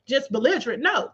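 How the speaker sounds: ordinary talk, nothing marked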